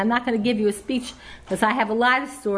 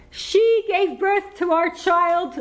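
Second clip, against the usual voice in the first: higher, slow mock of the husband's proud announcement; imitating husband; 8 semitones higher, slower than usual. Quoting her husband's speech, a higher, slow mock voice. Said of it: "I heard this".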